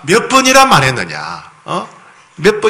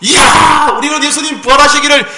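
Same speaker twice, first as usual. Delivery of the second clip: jubilant shouting; 9 semitones higher than usual. Pretend. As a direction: shout for joy like disciples who believed. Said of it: "막 박수치고 막 소리를 지르고".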